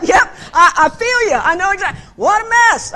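hammed-up I-feel-you exclaim; emphatic sympathy. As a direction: cry out in fellow feeling. Empathizing with rehab lady.

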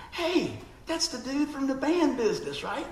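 high, slow delighted realization; surprised recognition. Retold or imitated. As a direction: stretch it out as it dawns on you. Recognizing old friend.

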